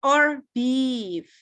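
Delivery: slow 'or beef' gliding down to finish; falling final option. Shown as a demonstration. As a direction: close the choice with a long fall on beef. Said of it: "biasanya dia menurun ke bawah".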